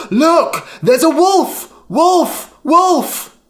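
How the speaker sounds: high, loud cry of alarm; shouting alarm. A narrator's reading. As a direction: yell it to the whole field. Lyman raises the wolf alarm.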